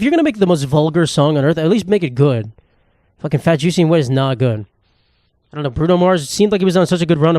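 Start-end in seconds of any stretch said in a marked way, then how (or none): none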